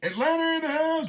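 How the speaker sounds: very high, playful holler; hype shout-out. Real, not acted. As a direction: sing out the city up high. Shout-out to listeners.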